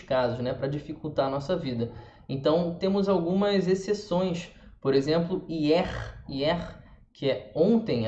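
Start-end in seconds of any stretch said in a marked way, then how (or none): 5.5-6.8 hier with the final R audible; French hier, "ié" closing on a throaty R; pronounced final R. Shown as a demonstration. Teacher giving an exception for students, hier with R. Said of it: "a gente fala o R".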